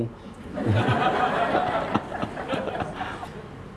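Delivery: a short, hushed laugh; soft laugh. Real, not acted. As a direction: laugh quietly at your own joke. Laughs at own joke about income.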